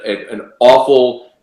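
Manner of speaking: ordinary talk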